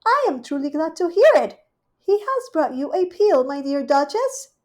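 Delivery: high, fast, loud solicitous cat; Rivy the cat, high and bustling; fussing hostess cat. Acted. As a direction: cluck over your guest.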